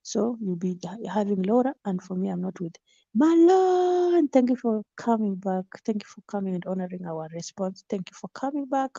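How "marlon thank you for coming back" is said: high, loud call of a name; excited greeting. Real, not acted. Greeting a returning viewer with an excited shout. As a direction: greet him with a joyful shout.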